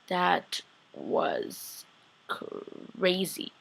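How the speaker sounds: very soft, broken by a pause; stunned hush. Real, not acted. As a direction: breathe it out in hushed disbelief. Stunned reaction, drawn out.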